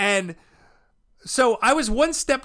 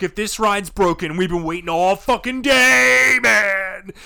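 rising, louder mock complaint; pissed-off crowd; 6 dB louder, slower than usual. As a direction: build to a fed-up yell. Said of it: "starting to get pissed".

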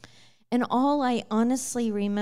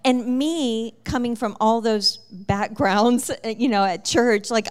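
normal talking voice

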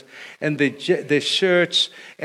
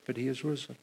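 softer, lower: but he is risen; quiet refrain; 3 semitones lower, 12 dB quieter, faster than usual. Acted. Preacher answering each suffering of Christ, soft and low. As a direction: let the answer fall soft and sure.

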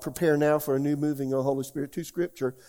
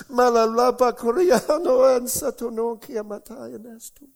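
loud, slow, ecstatic tongues with laughter; speaking in tongues; 8 semitones higher, 7 dB louder, slower than usual. Real, not acted. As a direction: pour out tongues in joyful worship, laughing. Speaking in tongues while worshipping.

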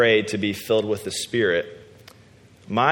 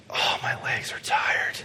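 slow, breathy whisper under his breath; whispered complaint; 5 dB quieter, slower than usual. Retold or imitated. Restless guy during prayer, whispered grumble.

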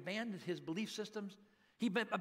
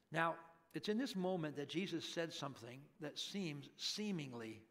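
normal talking voice

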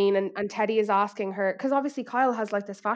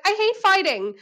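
high, louder mock whine; mock whiny Kyle; 10 semitones higher, 5 dB louder than usual. Mocking Kyle claiming she hates conflict. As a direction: whimper it like a wounded star. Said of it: "She's like".

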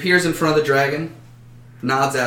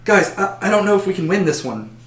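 a touch of nerves, higher and quick; worried halfling; 7 semitones higher than usual. Acted. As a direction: fret that the fight is lost. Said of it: "looking much more worried than the rest".

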